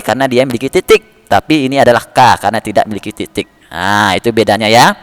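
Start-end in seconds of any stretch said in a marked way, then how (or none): none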